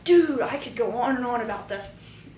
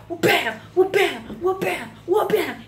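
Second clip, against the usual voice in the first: hammer hit sounds; 7 semitones higher, 4 dB louder than usual. Retold or imitated. Imitating hammer blows in fight.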